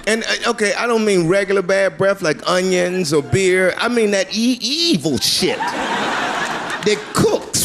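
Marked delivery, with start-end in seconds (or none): 4.6-7.6 very slow, laughing, drawn-out; disgusted exaggeration. Comic describing rotten breath, slow and disgusted. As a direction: stretch it out in mock disgust. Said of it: "describing awful breath".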